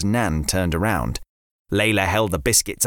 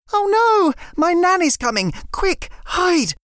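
put-on panicked girl. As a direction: hurry your friend out of sight. Layla panics as nan comes.

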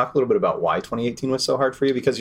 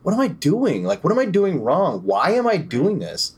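exasperated self-questioning; 10 semitones higher than usual. Retold or imitated. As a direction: throw up your hands in frustration. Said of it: "I was like".

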